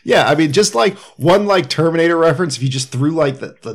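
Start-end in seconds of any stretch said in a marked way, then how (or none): none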